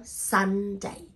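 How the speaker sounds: "Sunday" as a long SUN, then DAY; two drawn-out syllables. Shown as a demonstration. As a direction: stretch the N in "Sunday" before the second syllable. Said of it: "two syllable".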